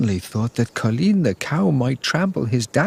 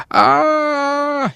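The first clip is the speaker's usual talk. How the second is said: sudden high-pitched cry of alarm; startled high shout of "Ah!"; 4 dB louder than usual. A narrator's reading. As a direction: yelp out in a much higher voice. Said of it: "he shouted very loudly".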